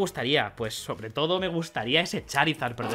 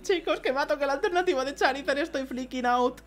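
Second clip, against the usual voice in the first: much higher, rising excitement; excited; 12 semitones higher than usual. Real, not acted. Telling viewers again about the Charizard, much higher and excited. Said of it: "Estoy flipando muchísimo".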